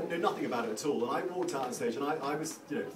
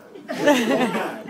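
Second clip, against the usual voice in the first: short loud laugh; real laugh; 11 semitones higher, 11 dB louder than usual. Real, not acted. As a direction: burst out laughing.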